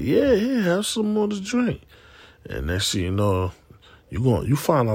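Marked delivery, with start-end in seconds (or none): 0.0-1.8 coaxing host. Retold or imitated. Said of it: "I be like".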